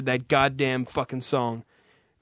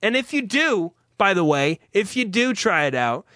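ad read; 4 semitones higher than usual. Acted. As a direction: sell it like a radio spot, then get cheeky. Reads the sponsor ad.

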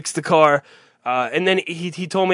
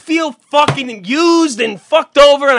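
fed-up outburst; 8 semitones higher, 6 dB louder, slower than usual. Real, not acted. Host asks how he feels.

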